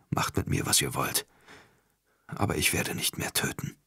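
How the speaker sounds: soft, breathless, unvoiced refusal; whispered defiance. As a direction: whisper it with resolve. Charbadan refuses, exhausted. (geflüsterter Trotz)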